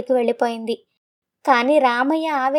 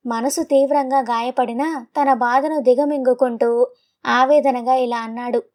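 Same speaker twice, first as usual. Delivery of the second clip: plain reading voice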